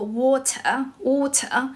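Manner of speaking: "water" in British English, crisp T, R dropped; British accent. Shown as a demonstration. English teacher giving the classic example, British accent. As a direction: say "water" British-style, ending without an r. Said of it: "就是British accent".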